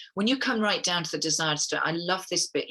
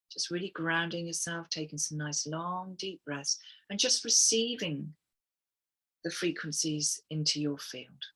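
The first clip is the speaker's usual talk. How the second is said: guided meditation. Acted. Leads the group through affirmations.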